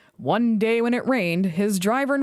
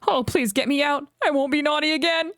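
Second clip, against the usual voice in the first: pleading; 7 semitones higher than usual. A narrator's reading. Thomas pleading.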